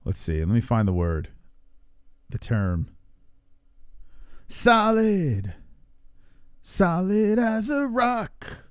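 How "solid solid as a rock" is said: mock tough guy. Repeating the tough boast.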